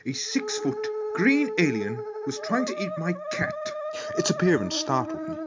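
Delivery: lightly posh storyteller voice. Acted. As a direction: read it in a hint of posh. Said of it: "it's even worse the second time".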